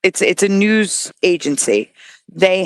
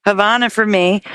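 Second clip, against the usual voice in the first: ordinary talk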